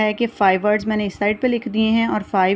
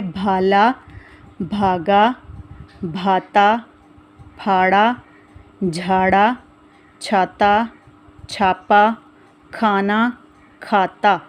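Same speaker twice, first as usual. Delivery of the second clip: reading words aloud; much slower than usual. Shown as a demonstration. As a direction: clear word-by-word reading for children. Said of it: "सबसे पहले पढ़ेंगे". (बच्चों को शब्द पढ़कर सुनाना)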